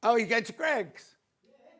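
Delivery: ordinary talk, nothing marked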